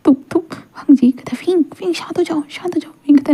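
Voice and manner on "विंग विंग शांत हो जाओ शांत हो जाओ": soft, higher, calming plea; a whispered, tender calm down; soothing hush. A narrator's reading. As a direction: hush him gently to calm him down. Wang Ji soothing crying Wing.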